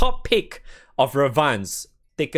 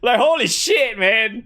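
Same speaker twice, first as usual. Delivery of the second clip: high, louder amazed exclamation; excited; 9 semitones higher, 4 dB louder, slower than usual. Real, not acted. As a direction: gush with real amazement. Real excitement about friend's gift.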